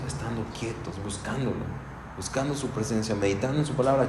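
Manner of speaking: soft, hushed answer; softly, as if demonstrating stillness; quiet. Real, not acted. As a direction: drop to a near hush. Answering how to know God.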